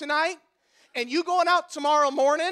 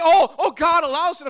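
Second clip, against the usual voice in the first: a raised, louder mock whine; mock objector; 4 semitones higher, 8 dB louder than usual. Preacher mimicking a congregant's excuse, raised mock whine. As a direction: lampoon the listener's excuse. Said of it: "You say".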